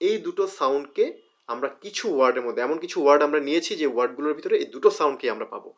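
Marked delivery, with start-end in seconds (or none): none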